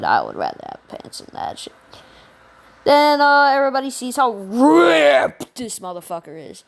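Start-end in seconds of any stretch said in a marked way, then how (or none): 0.0-1.7 low Deku voice. Retold or imitated. Deku voiced in retold story.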